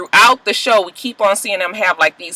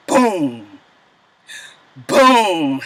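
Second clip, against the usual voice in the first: imitated gunshot; 5 semitones lower than usual. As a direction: mouth a dull gun boom. Mother imitating gun clicks.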